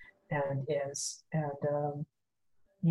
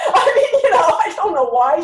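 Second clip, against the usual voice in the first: laughing hard through the words, much higher and louder; 12 semitones higher, 12 dB louder than usual. Real, not acted. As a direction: burst out laughing while trying to explain.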